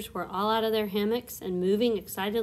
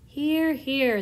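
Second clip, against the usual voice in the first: loud, higher cheer; cheering shout; 5 semitones higher, 6 dB louder than usual. A narrator's reading. Creatures agree with the ladybug, loud cheer. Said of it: "they all shouted".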